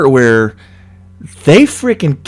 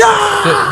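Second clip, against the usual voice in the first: mock panicked scream. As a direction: act out a breakdown with a high wail. Imitates a breakdown scream.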